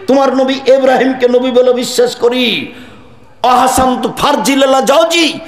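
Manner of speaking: high, ringing sermon voice calling out the prayer; fervent preacher voicing a woman's plea; impassioned preaching. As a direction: cry the prayer out to the crowd. Preaching the hadith of Sara.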